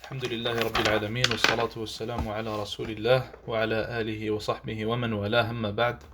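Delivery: low, soft Arabic recitation, run together evenly; formulaic opening invocation. Real, not acted. Teacher opening a lesson with praise of God, formulaic recitation.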